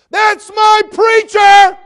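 put-on very high, drawn-out teenage scream; imitating a girl shrieking across a store; top-of-lungs yell. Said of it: "at the top of her lungs".

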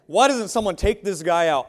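emphatic, outraged rhetorical question; indignant call. Retold or imitated. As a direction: demand that someone take the bully out. David indignant at Goliath's taunts.

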